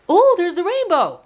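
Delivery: high, loud burst of delight; real joy at spotting a rainbow; excited discovery. Real, not acted. Suddenly spots a rainbow.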